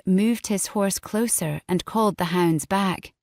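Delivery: plain reading voice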